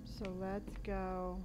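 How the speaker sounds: hushed whisper, hiding from danger; whispering while hiding. Real, not acted. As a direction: keep it barely audible. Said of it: "I have to be quiet also".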